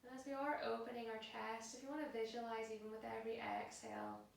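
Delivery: soft, unhurried yoga relaxation voice; guided meditation. Acted. Yoga teacher settling class into a held pose, soft and calm. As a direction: guide them into stillness, hushed and spaced out.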